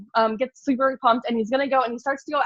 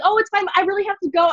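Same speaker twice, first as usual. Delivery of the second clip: high, loud excuse, re-enacted; hurried brush-off; 8 semitones higher, 5 dB louder than usual. Escaping the family at the store, re-enacted flustered brush-off. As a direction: brush them off loudly in a raised voice.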